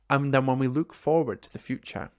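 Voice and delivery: ordinary delivery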